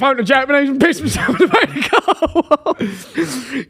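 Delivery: high-pitched, laughing outburst; laughing confession. Real, not acted. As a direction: squeal it out through laughter.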